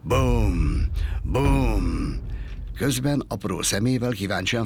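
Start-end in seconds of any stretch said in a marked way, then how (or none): 0.0-2.2 loud, drawn-out imitated bangs; heavy resounding impacts; furious booms. A narrator's reading. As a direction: boom out each angry blow. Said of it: "Dühösen döngette a fát".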